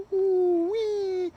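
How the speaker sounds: high, sing-song woo-wee; sarcastic whoop at the welcome lights; mock-impressed woo-wee. Real, not acted. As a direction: tease it like a cheap thrill. Lights turn on approaching.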